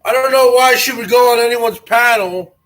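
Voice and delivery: ordinary talk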